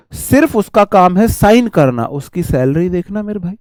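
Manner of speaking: normal talking voice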